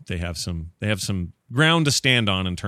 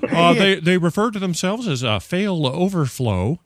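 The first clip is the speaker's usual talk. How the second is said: put-on old grandpa voice; 7 semitones higher, 3 dB louder than usual. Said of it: "I sound like an old man doing it, like my grandpa going".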